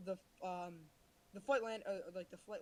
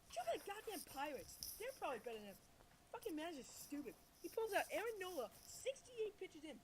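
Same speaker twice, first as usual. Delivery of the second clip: higher, fired-up rant at the team manager; exasperated rant; 7 semitones higher than usual. Acted. Friend dares him to rant about the Phillies manager, high exasperated rant. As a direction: vent at full steam, voice climbing high. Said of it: "Let's see that rant".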